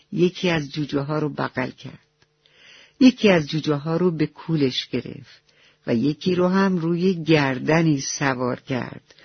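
plain reading voice

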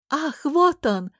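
high, drawn-out delighted exclamation; joyful cry. A narrator's reading. Court lady spots him from the window, joyful cry. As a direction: cry out gladly, slow and high. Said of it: "радостно закричала одна из дам".

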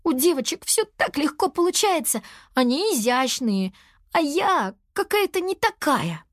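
put-on high-pitched little girl's complaint; self-pitying lament. A narrator's reading. Character laments her own clumsiness. (жалобно, высоким голоском)

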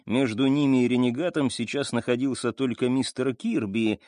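ordinary delivery

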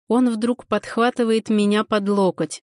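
ordinary delivery, nothing marked

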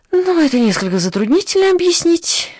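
high-pitched, swooping embarrassed answer. A narrator's reading. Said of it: "ответил он в смущении". (смущённо, высоким голосом)

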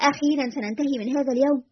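ordinary delivery

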